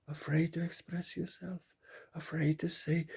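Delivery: ordinary talk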